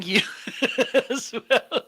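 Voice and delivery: high, giggly laugh through the words; real long high giggle; cracking up. Real, not acted. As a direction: laugh helplessly until it trails away. Cracks up at own beard joke.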